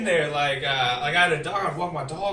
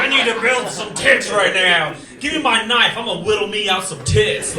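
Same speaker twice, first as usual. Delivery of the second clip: horny sculptor; 4 semitones higher, 9 dB louder than usual. Retold or imitated. Voicing horny ancient sculptors.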